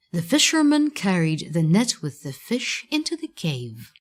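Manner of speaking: plain reading voice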